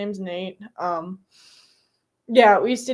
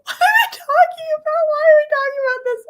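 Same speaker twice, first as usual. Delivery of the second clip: much higher, loud incredulous cry, fast at the end; shocked outburst; 6 dB louder, faster than usual. Retold or imitated. At work hearing coworkers' dirty jokes, shrieking in disbelief. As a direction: squeal in disbelief. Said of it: "I'm just like".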